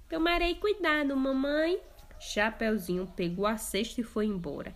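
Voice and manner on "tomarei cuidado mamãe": put-on higher, sweet little girl. Girl promising her mother, sweet higher voice. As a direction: promise it like a good child.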